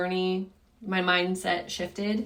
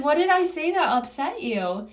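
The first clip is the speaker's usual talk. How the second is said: much higher, mildly alarmed; pitched-up, puzzled appeal; retold concerned question; 8 semitones higher than usual. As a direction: re-enact a worried daughter asking. Daughter retells asking upset mom.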